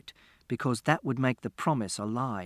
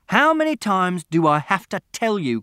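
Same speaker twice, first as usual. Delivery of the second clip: loud, higher exasperated scolding; fed-up angry father voice; 6 semitones higher, 8 dB louder than usual. A narrator's reading.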